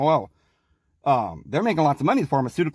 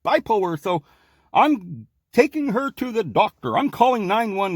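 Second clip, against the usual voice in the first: mock worried parent; 5 semitones higher than usual. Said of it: "your parents get, say".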